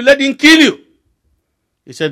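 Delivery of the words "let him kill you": high, loud punchline; preacher's shocking advice, shouted high; emphatic shout. Retold or imitated. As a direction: hit "kill" high and hard to shock. Preacher's emphatic answer repeated.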